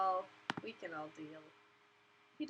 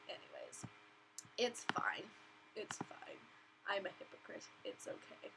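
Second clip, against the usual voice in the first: whispered; 11 dB quieter than usual. Real, not acted. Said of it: "whispered self-reassurance".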